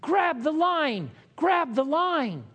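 slow, high, loud rescue call; a Coast Guard crew shouting to people in the sea. Retold or imitated. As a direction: call out over cold water. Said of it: "we're shouting".

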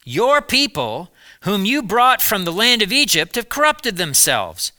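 God urgently commanding Moses. A narrator's reading. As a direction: call it down from the mountain, urgent. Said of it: "told Moses".

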